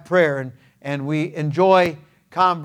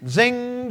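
high, drawn-out ringing "zing"; sustained, higher-pitched zing; sudden zing noise; 7 semitones higher than usual. Shown as a demonstration. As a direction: imitate a flash of recall zipping in. Just like that.

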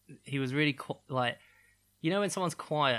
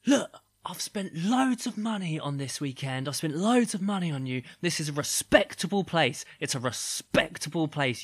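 loud, higher indignant rant; mock angry screaming; 3 semitones higher, 5 dB louder than usual. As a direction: imitate an outraged man telling off his partner. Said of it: "like, screaming at each other".

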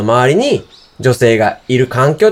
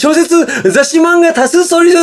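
customer service voice; 3 dB louder than usual. Shown as a demonstration. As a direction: announce the stock like a store clerk. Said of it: "大体このテンションなんですよ". (高くゆっくり伸ばす店内アナウンス)

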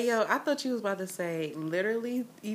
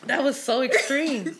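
laughing reaction; 5 semitones higher, 8 dB louder than usual. Real, not acted. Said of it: "I was not expecting you".

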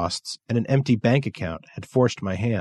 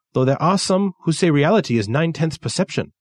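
put-on higher, lightly accented Japanese dealer; gentle Japanese dealer musing, higher pitched; 6 semitones higher than usual. Kensuke voiced, Japanese accent.

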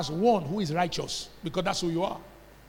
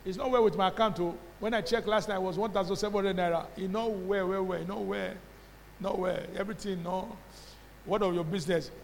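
lightly acted, softer grumble of defeat; complaining church member; 7 dB quieter, faster than usual. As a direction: play a discouraged believer, softly. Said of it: "You say, Pastor, you don't understand".

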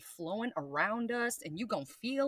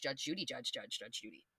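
low, soft sing-song judge Judy; muttering; 8 semitones lower, 10 dB quieter than usual. Shown as a demonstration. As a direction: mutter it like idle gossip. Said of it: "likes to talk about others and judge".

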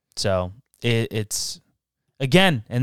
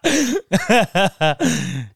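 laughing; 6 semitones higher, 6 dB louder than usual. Real, not acted. Laughing along, loud.

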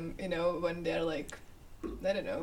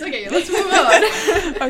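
laughing; 8 semitones higher, 12 dB louder, slower than usual. Real, not acted. Laughing, wrapping up a topic.

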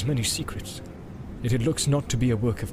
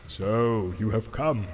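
low, slow and grave, a touch of menace; the wizard greets his rival coldly, slow and low; grave Saruman; 3 semitones lower, 3 dB quieter, slower than usual. A narrator's reading. Saruman greets Gandalf, slow and grave. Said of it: "he said to me gravely".

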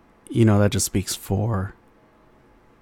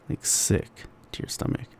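whispered; 4 semitones higher, 9 dB quieter than usual. Shown as a demonstration.

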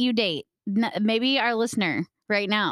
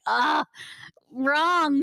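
loud, higher exasperated buzz-off; mock enraged; 5 semitones higher, 4 dB louder than usual. Reacting to a one-word text, mock outrage. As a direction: reject it with a raised, irritated verdict. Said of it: "It literally enrages me".

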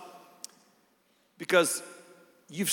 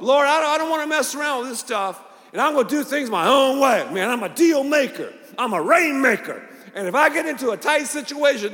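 cocky self-made man; 6 semitones higher, 4 dB louder, faster than usual. Retold or imitated. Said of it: "are we kind of a part of the bless me club?".

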